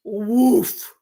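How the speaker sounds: single loud bark; imitated big dog woof. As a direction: bark once like a huge dog. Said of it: "And he goes".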